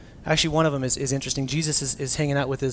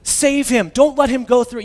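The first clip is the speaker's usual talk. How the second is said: higher, louder urgent prayer; pleading prayer, loud and pitched up; fervent pleading prayer; 8 semitones higher, 9 dB louder than usual. Retold or imitated. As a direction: plead with God to spare him. Acted fervent prayer for friend.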